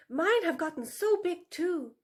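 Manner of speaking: appeasing vixen. A narrator's reading. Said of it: "Tula said".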